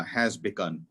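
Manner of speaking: ordinary talk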